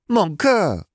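high, loud squeal of delight; excited greeting. A narrator's reading. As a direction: cry it out as you fling yourself at him. Gabby greets Harry with a hug.

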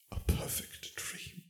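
slow, voiceless whisper. A narrator's reading. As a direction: breathe it out slowly. Tearful, intimate moment, breathed words.

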